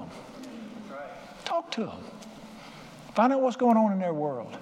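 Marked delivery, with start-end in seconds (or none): 1.4-2.0 "Talk to 'em." said again much softer, almost gently; softer repeat. Shown as a demonstration. Preacher repeating his plea to the congregation, much softer. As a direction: drop the volume and let "Talk to 'em." sink in. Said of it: "Talk to 'em".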